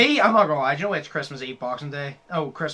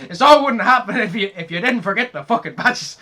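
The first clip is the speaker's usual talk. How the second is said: higher, louder indignant outburst; mock angry rant; 5 semitones higher, 8 dB louder than usual. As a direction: rant like Agnes again. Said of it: "she goes".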